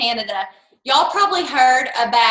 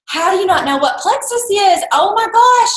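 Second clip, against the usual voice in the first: high, exclaimed astonishment, building louder; mock disbelief; 7 semitones higher, 4 dB louder than usual. Recruiter to Diane who doesn't know Plexus, mock shock. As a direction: act scandalised, rising to an outburst.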